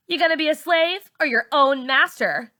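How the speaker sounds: put-on high challenging teen girl; Char's challenge. A narrator's reading. Char challenging narrator.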